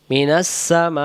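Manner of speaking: slowly drawn out; slow teaching recitation. Shown as a demonstration. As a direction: stretch each syllable for the pupil. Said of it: "Hamza জের ই".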